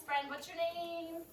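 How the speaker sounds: normal talking voice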